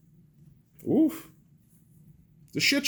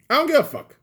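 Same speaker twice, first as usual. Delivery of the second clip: much higher and louder, hurled out; defiant shout; 9 dB louder, faster than usual. Real, not acted. To mainstream news viewers, a defiant yell. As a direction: yell it back at critics, fed up. Ranting repeat, shouted second take.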